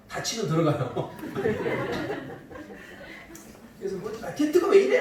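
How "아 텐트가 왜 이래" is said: mock complaining daughter. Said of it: "막 이러면서 불평하죠".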